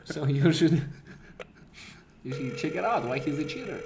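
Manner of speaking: normal talking voice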